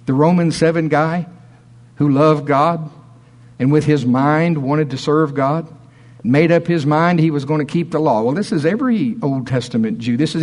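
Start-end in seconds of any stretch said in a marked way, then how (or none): none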